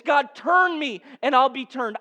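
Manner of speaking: prayed out loud with fervour; fervent prayer. Acted. Preacher voicing a plea to God before the church, fervent. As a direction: cry it out to God with full conviction.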